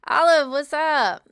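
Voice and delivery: loud, slow, calling out a greeting. Real, not acted. Streamer calling out to a viewer by name, loud. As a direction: call the name out across the room.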